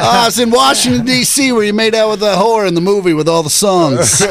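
high, loud over-familiar fan; hammed-up gushing at a star; Bobby Kelly. Playing a comic accosting Tom Hanks, Bobby Kelly impression. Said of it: "Can I be Bobby Kelly".

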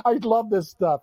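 ordinary delivery

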